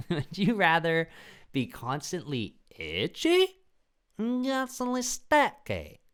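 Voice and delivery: very high, slow, squeaky voice; mock falsetto reading; silly squeaky voice. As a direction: squeak the question out like a cartoon. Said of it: "rereads question in silly voice".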